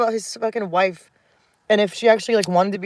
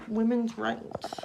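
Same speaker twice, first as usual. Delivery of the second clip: mock echo; 3 semitones higher, 9 dB quieter, much slower than usual. Real, not acted. Bitter echo of her own words.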